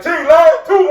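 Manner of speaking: high, loud shout across the shop; shouted call. Retold or imitated. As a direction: yell his name to the phone. Coworker calls out over shop.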